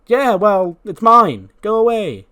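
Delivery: put-on high, loud, childish possessiveness; petulant high whine. Charlotte shooing the cake-eater away, high petulant whine. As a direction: whine it up high like a spoiled kid. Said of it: "And Charlotte's like".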